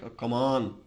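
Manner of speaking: usual speaking voice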